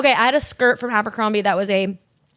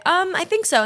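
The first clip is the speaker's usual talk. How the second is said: unsure customer; 8 semitones higher than usual. Acted. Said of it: "And you say".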